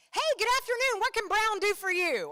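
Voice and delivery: hammed-up bright counter clerk; cheery clerk. UPS clerk greeting a customer, loud and chirpy. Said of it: "they're like".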